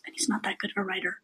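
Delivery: fast whispered aside. Real, not acted. Snarky aside about Stephen King.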